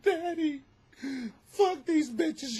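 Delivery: high, slow little dog voice. Pretend. Tiny Chihuahua tells her daddy off, high and slow. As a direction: voice the tiny dog as a sassy child. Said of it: "She's like".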